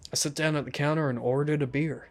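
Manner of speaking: ordinary delivery, nothing marked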